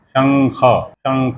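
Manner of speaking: slow, careful शंख with the nasal as ang; pronouncing shankh. Shown as a demonstration. Teacher slowly showing students how शंख is said. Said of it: "कैसे उच्चारण करते हैं".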